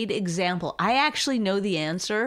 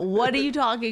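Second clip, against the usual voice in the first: normal talking voice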